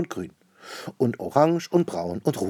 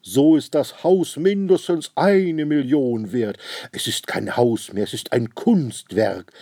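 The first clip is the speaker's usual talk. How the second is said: loud, slow, grand raving; enthralled; 6 semitones higher, 7 dB louder, slower than usual. Acted. Rich man declares the house an artwork, enthralled. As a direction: proclaim it slowly like a great marvel.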